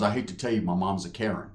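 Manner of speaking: low confiding dude. Retold or imitated. Son confides about his mother.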